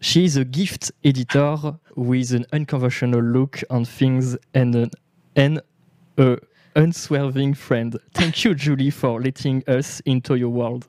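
slow English reading in a heavy French accent; terrible French accent. Real, not acted. Host reading praise of his guest, terrible French accent. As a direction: pick through the English words with a French accent. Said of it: "j'ai un terrible accent".

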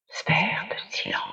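hushed, voiceless whisper. A narrator's reading. Attacker casting a silence spell on the king, whispered. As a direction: whisper it under your breath. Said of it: "chuchota l'agresseur".

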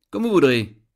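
ordinary delivery, nothing marked

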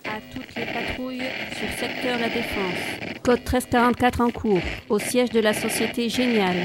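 dispatcher over radio; crackly radio dispatch; police radio. Acted.

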